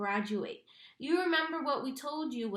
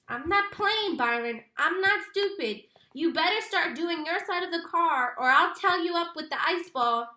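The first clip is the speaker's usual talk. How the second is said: angry refusal; 5 semitones higher, 5 dB louder than usual. A narrator's reading.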